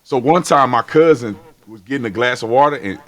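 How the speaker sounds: usual speaking voice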